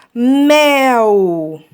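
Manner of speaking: "mel" held long as a single sound; drawn-out, emphatic "mel"; one long syllable. Shown as a demonstration. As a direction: stretch mel out in one slow beat. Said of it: "Mono significa um".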